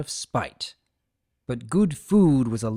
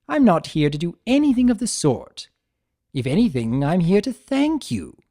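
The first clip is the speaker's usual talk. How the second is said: higher, louder, gleeful chaos spirit; playful trickster Discord; 6 semitones higher, 5 dB louder than usual. Acted. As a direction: tease him like a smug jester. Discord voiced in the story.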